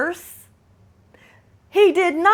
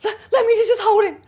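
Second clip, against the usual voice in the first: high, fast laughing plea; mock whiny ex; 8 semitones higher, 3 dB louder, much faster than usual. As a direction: imitate the ex pleading, higher and hurried. Ex begging to hold son.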